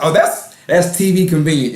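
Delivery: ordinary talk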